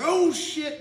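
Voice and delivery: high, loud, drawn-out cry of dismay; alarmed exclamation. Retold or imitated. Angler sees his line is gone, alarmed. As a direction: let the alarm swoop up high on it.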